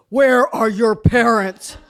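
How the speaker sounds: slow, probing adult voice; mock stern grown-up asking slowly; stern stranger. Stranger questioning kids.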